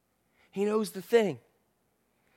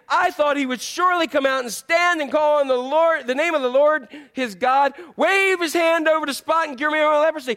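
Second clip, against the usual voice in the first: louder, higher indignant rant; a touch of an offended commander; angry complaint; 8 semitones higher, 5 dB louder than usual. Retold or imitated. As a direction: vent the insult of being snubbed. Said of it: "went away angry and said".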